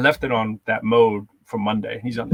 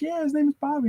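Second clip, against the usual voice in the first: much higher, sugary stranger voice; sweet luring voice. Retold or imitated. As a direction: lure the kid in with a sing-song. Stranger lures kid.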